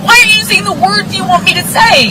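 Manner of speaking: high, loud sarcastic imitation of the partner; mocking the partner. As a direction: throw their words back at them mockingly. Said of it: "and then saying".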